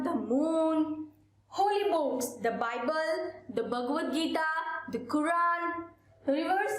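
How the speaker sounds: normal talking voice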